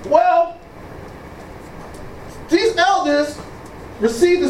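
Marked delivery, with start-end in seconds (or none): none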